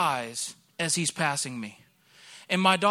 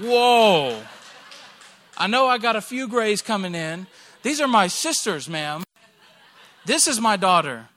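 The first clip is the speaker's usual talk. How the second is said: mock outrage; 2 semitones higher, 8 dB louder than usual. Pastor protesting loudly to the lady that he's not the dad. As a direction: protest loudly, half laughing, at the mix-up. Pastor retorts, mistaken for father.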